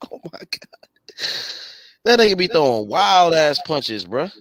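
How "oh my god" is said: breathless, near-silent laugh through the words; can barely get it out for laughing; laughing disbelief. Real, not acted. Cohost marvels at sloppy boxing, laughing breathlessly.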